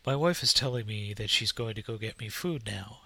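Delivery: ordinary talk, nothing marked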